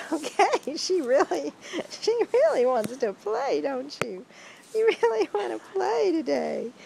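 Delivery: high, bright sing-song pet voice. Real, not acted. Cooing to her dog.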